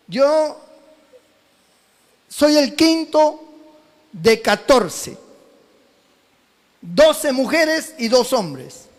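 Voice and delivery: normal talking voice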